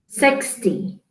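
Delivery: ordinary talk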